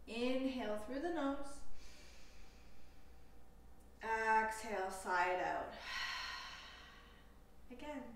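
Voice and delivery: slow, calm meditation voice with long pauses; guided meditation. Acted. Yoga teacher leading listeners through a compassion meditation, calm guided delivery. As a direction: guide them unhurriedly into stillness, leaving space between phrases.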